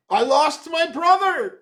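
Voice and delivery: high, loud protest; crying out. A narrator's reading. Another man joining the protest about wild hogs, crying out. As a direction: call it out over the others. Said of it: "cried another".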